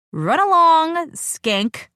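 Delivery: high, loud, slow brush-off; dismissive. Acted. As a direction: shoo her away with contempt. Dismissing the other woman.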